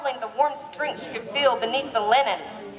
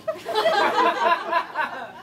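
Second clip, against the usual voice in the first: high burst of laughter; cracking up mid-read; laughing; 4 semitones higher than usual. Real, not acted. Reader cracks up mid-scene.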